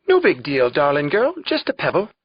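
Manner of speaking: mocking Irish brogue, lilting upward. Tom mimics Mac reassuring Kate, hammed-up Irish brogue. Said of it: "in my best Irish brogue".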